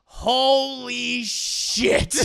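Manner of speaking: loud, drawn-out shock; stunned exclamation. Acted. Streamer reacts to the twist for chat, stunned. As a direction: stretch both words out in amazement.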